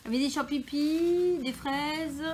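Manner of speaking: high, loud and drawn out; delighted reading the flavours; excited. Real, not acted. Reading out calendar contents, amused.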